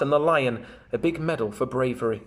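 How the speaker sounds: plain reading voice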